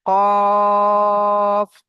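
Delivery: কফ held very long and loud with rounded lips, then a shorter long ত; three-alif stretch. Shown as a demonstration. Teacher shows students the recitation lengths, long stretched কফ then ত. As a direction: stretch the vowel of কফ three beats, ত one, both round-mouthed. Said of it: "কফ তিন আলিফ টান".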